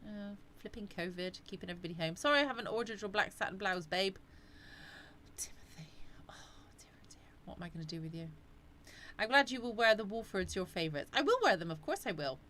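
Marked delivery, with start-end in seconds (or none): 5.4-8.3 hushed, mock-disappointed whisper; playful scolding under the breath; teasing whisper. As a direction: tut at him in a near-whisper. Mock-scolding a chat viewer.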